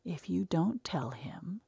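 soft, slow breathy whisper. Acted. Maisie May pressing against Tom, slow secret whisper. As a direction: murmur it close in his ear. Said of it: "she whispered again".

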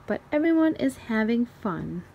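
ordinary delivery

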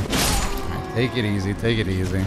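low, soft self-soothing; self-calming. Real, not acted. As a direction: talk yourself down under your breath. Calming self down after panicking.

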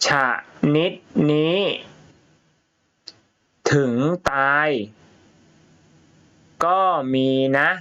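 a teacher reading a story to the class, word by word; reading to children. Shown as a demonstration. Reads story aloud to pupils.

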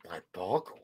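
lightly voiced movie character; put-on voice. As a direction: growl it under your breath.

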